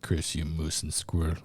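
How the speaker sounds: put-on Boris Russian accent. Cartoon spy cursing the heroes in a low Russian accent. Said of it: "Boris and Natasha one".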